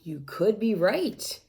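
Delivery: ordinary delivery, nothing marked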